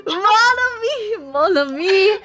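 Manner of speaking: high, drawn-out mock-French indignation; hammed-up French accent, scandalized. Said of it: "I can do French even better".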